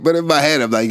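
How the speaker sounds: ordinary delivery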